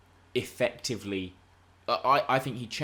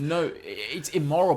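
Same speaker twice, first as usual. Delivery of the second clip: higher and louder concession, rushing to the but; eager rebuttal; 8 semitones higher, 7 dB louder than usual. Real, not acted. Conceding to co-host, raised and eager. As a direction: grant the point fast, then push back.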